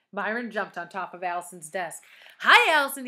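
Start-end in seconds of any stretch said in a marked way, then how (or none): 2.4-3.1 loud, high call; exuberant greeting. A narrator's reading. Myron landing on Allison's desk, loud cheeky greeting. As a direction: holler a cheeky hello.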